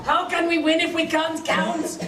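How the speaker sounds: lightly voiced Scots warrior, lowish; a Braveheart Scots burr, half laughing; mock Scottish accent. Scots ally frets about counting in a Scots accent.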